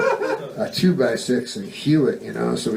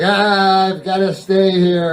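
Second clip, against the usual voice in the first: higher-pitched whining ghost; acted whiny complaint; 4 semitones higher, 4 dB louder, slower than usual. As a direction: moan like a self-pitying ghost. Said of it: "And they're whiny".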